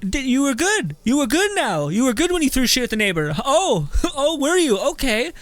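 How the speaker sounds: high, loud mock scolding of a kid; mock parent scolding. Parent in the child's face, loud mock scolding. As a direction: get in the kid's face and chew him out, hammed-up. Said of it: "Got right up into your child's face".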